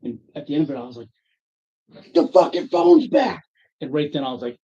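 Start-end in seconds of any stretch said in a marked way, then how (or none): 2.1-3.4 high, loud, slow furious demand; angry demand. Retold or imitated. Customer ending an argument with a salesman, angry and loud. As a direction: punch it out loudly at the salesman.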